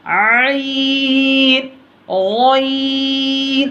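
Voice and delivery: आइन long from the throat, गैन long and heavy; drawn-out ain and ghain. Shown as a demonstration. Teacher reciting आइन and heavy गैन for pupils. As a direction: stretch both letters, गैन thick. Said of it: "गैन को मोटा पढ़ना है".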